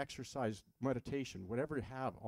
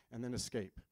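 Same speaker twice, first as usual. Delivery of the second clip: ordinary delivery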